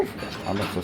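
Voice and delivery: normal talking voice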